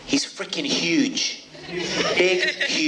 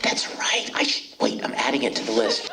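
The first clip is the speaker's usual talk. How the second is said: normal talking voice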